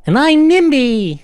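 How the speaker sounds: loud, very high drawn-out doll voice; high, slow, loud toy voice; Nimby the doll. Acted. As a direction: squeal it out like a talking doll. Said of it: "the doll goes".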